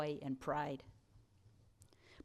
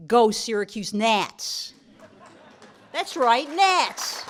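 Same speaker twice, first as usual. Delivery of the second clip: loud, high rallying shout; big high-pitched cheer from the podium; rousing team cheer; 6 semitones higher, 12 dB louder, slower than usual. Real, not acted. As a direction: belt it out like a fan rallying the crowd.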